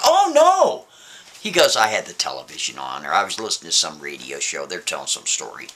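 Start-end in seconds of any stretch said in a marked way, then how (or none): 0.1-0.9 high-pitched, drawn cry of dismay; alarmed oh no. Acted. Man caught out by Rachel's question, high alarmed dismay. 1.8-5.7 low, softer, offhand excuse; covering up. Acted. Man explaining the overheard whispers away to Rachel, low and offhand.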